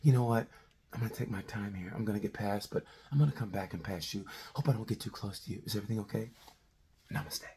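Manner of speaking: like a kinder, gentler Marquez politely passing; gentle soft-spoken. Retold or imitated. Mock-polite rider, soft and gentle. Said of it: "the new kinder, gentler Marquez".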